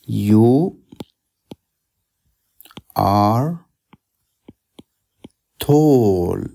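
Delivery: You are tall, word by word with long gaps; slow example sentence. Shown as a demonstration. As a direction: give the example sentence slowly with pauses between words. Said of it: "I say, for example".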